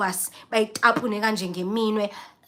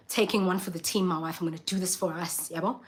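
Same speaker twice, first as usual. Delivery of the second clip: quieter, lower resolve; husband's hushed vow; 4 semitones lower, 8 dB quieter than usual. Retold or imitated. Husband quietly vowing to his wife to eat. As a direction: mutter bravely under breath. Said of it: "My husband is just like".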